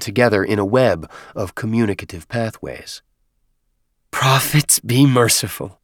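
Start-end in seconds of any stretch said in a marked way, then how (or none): 4.1-5.8 slow, understated wail to oneself; mild wail. A narrator's reading. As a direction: wail a little, slowly, as a despairing prayer. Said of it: "he wailed to himself".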